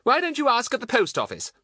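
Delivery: high, loud, exasperated question; impatient. A narrator's reading. Thin woman sending the stranger elsewhere, impatient. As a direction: send him off, high and loud.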